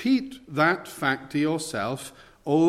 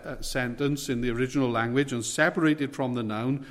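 ordinary talk

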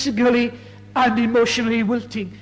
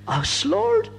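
mock the disciples' startled doubt, pitched up; incredulous surprise; 10 semitones higher than usual. Preacher voicing the disciples' surprised disbelief, mildly.

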